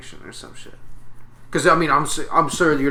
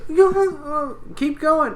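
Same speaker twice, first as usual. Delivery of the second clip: high squeaky voice; 3 dB louder, much slower than usual. Pretend. Voicing Doughboy being poked.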